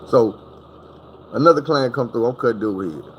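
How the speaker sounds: ordinary talk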